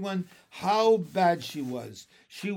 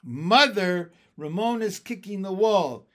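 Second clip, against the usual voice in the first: loud, higher, calling out; tattling cry; 5 semitones higher, 9 dB louder than usual. A narrator's reading. Beezus calls to her mother to tattle, loud. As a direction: tattle to mother across the house. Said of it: "cried Tattletale Beezus".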